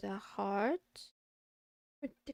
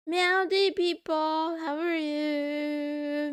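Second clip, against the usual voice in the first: slow, drawn-out, louder kitty greeting; cat voice; 5 semitones higher, 6 dB louder, much slower than usual. Pretend. As the self-declared bad kitty greeting viewers, slow playful cat voice. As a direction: stretch it out like a cat greeting everyone.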